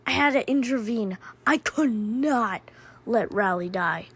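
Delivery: usual speaking voice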